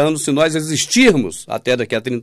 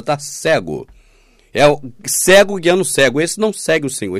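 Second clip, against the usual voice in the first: ordinary talk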